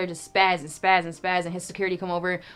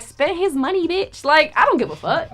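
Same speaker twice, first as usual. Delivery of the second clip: laughing defiance; 8 semitones higher, 5 dB louder than usual. Retold or imitated.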